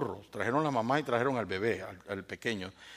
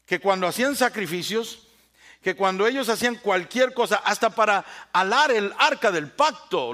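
loud, raised sermon push; fervent preaching; 7 semitones higher, 8 dB louder than usual. Acted. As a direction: preach it hard to the congregation. Preaching at full force. (predicación con fuerza y voz alzada)

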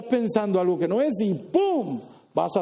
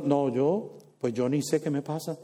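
clueless excuse; 6 semitones lower, 4 dB quieter than usual. Acted. Quoting a clueless person.

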